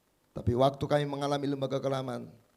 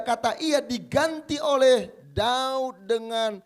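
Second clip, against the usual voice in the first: loud, high preaching at full force; 8 semitones higher, 10 dB louder, slower than usual. Acted. Pastor to the congregation, fervent loud preaching. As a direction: proclaim it to the whole church.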